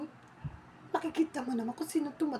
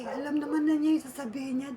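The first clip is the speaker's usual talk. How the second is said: ordinary talk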